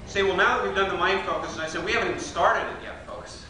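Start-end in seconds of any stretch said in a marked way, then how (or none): none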